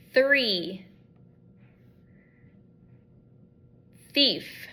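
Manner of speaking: "Three" and "Thief" each with the tongue out for a breathy unvoiced th; quiet TH demo. Shown as a demonstration. Therapist modeling quiet th words for a student, careful unvoiced th. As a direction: model each word alone, voice off on the th. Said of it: "after I make that T H sound".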